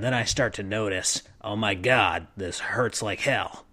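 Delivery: gravelly, low and soft. Shown as a demonstration. Game master hurting from his character's voice, low gravelly rasp. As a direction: keep up a low, quiet gravel through the line. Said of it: "gave him kind of a gravelly, like, [name] type voice".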